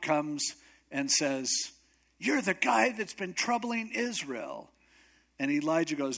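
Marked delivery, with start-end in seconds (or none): 2.2-4.7 high-pitched accusation, acting out a king; accusing. King accusing the prophet of troubling Israel, high and accusing. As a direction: point the finger, pitched high.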